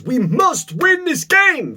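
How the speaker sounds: high, slow, win-at-all-costs tone; intense. Retold or imitated. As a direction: say it like a pushy competitor. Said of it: "If my mindset was".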